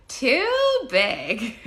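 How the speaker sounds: high, loud imitation of a child's catchphrase; drawn-out, loud kid voice; Zoe. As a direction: squeal it up like a little kid. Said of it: "so now all we say is just".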